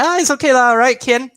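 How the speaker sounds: high, loud, breezy dad voice; imitating a cheery dad. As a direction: play the overly chirpy father brushing it off. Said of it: "my dad is like".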